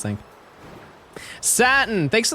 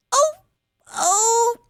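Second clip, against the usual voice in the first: ordinary talk, nothing marked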